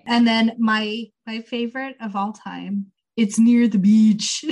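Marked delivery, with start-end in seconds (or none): 3.2-4.4 mock Jamaican accent. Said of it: "pretending to be Jamaican".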